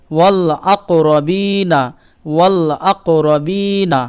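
"ওয়াল আকরাবিনা" slowly, with a long held "বি"; slow chant-like model of "ওয়াল আকরাবিনা"; lam fully pronounced. Shown as a demonstration. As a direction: clear l in "ওয়াল", stretch the i of "আকরাবিনা". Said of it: "লামের উচ্চারণ পূর্ণভাবে হবে".

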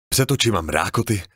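ordinary delivery, nothing marked

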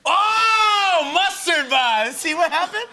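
high, loud triumphant yell; excited shout. Real, not acted. Host cheering the guest's right mustard guess, excited shout. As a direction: crow it out like you just won.

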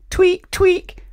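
loud squeaky tweak noise; high squeaky tweak. A narrator's reading. Sound effect.

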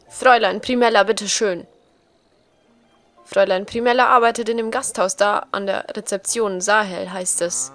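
loud, insistent character voice; emphatic NPC voice. Acted. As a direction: correct him firmly. Reads NPC dialogue emphatically. (nachdrücklich korrigierend)

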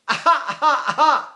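put-on short, high baby wails; baby cry. Shown as a demonstration. Teacher shows students a baby's cry, high short wails. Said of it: "their corners are like this".

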